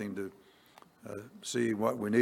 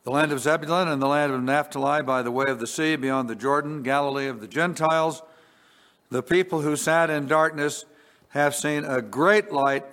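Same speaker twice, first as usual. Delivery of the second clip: raised, brisk reading from the pulpit; scripture reading; 3 semitones higher, 4 dB louder than usual. Acted. Preacher reading Matthew to congregation, raised declaiming. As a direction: declaim the verse to the church. Reads Matthew four aloud.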